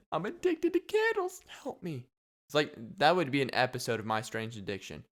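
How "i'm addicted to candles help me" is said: much higher, squeaky cry for help; squeaky mock plea. Joking candle addict squeaks a plea for help.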